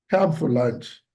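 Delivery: offhand invitation said as mere formality; half-meant invitation. Shown as a demonstration. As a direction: invite without meaning it. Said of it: "How do I sound".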